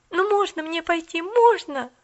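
put-on high, loud pleading girl; begging. A narrator's reading. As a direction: beg the lady to let you go. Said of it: "взмолилась Полианна".

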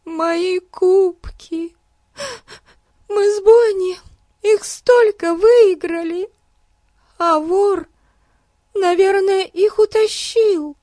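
put-on high, tearful young girl. A narrator's reading. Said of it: "между всхлипываниями".

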